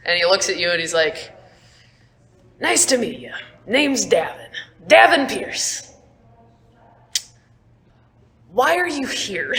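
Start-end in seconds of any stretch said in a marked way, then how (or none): 2.6-9.5 higher, friendly greeting voice; friendly Davin. Acted. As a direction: introduce yourself brightly. Davin introduces himself.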